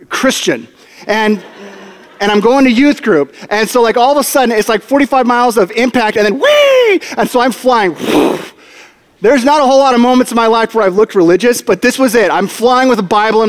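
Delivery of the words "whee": very high, drawn-out mock squeal; a comic kid-on-a-slide whee; playful whee. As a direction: sail up high as if flung through the air. Imitating himself flying through air.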